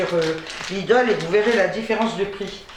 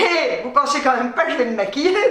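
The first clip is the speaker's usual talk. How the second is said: ordinary talk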